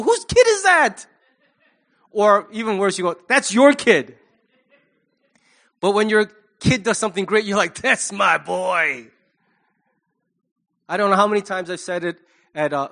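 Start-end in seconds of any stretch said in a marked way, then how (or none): 0.0-1.1 loud, high mock outrage; exasperated parent. Parent of a child acting like an idiot, mock outrage. 3.3-4.2 loud, high mock accusation; accusing parent. Parent blaming the other for the child's behavior, mock accusing. 7.8-9.1 loud, drawn-out proud boast; proud parent. Acted. Parent whose kid does something great, proud crowing.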